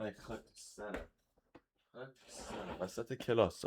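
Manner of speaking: real hushed grumble to oneself; self-reproach under breath. Real, not acted. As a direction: curse yourself almost inaudibly. Self-reproach aside during class.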